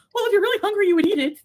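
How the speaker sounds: high, loud mock telling-off; scolding grandma. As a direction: imitate an exasperated grandmother. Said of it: "And my grandma was like".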